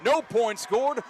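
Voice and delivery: no good, called at full excitement, slowing at the end; thrilled call. Real, not acted. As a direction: shout the miss to the whole stadium. Missed field goal call.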